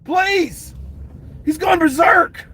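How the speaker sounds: frantic shouting over a berserk fighter; roid rage yell. Retold or imitated. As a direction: scream like you've lost it. Roid rage and scared onlookers.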